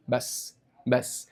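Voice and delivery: hushed stop. Real, not acted. Hushed cutting off.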